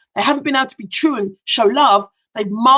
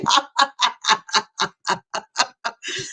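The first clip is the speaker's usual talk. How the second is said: real amused laugh rising up; 3 semitones higher, 3 dB quieter than usual. Real, not acted. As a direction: laugh out before catching herself. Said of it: "Sorry, I shouldn't laugh".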